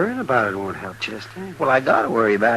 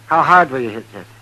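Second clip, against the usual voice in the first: ordinary talk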